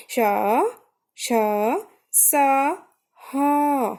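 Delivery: श, श, स, ह each held long on flowing breath; drawn-out sibilants. Shown as a demonstration. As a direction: stretch श, श, स, ह out one at a time. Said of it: "तो इसमें ज़्यादा समय लग रहा है".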